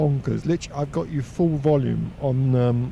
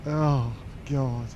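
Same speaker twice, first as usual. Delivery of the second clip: ordinary talk, nothing marked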